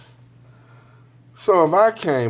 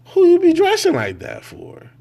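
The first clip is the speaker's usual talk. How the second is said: incredulous shout; 6 dB louder than usual. Real, not acted.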